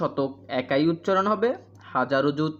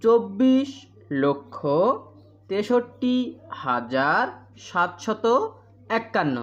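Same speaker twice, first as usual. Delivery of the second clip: word-by-word repetition; 2 semitones higher, much slower than usual. Shown as a demonstration. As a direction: stretch চব্বিশ and তেষট্টি, pause after every number word. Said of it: "আবার বলি".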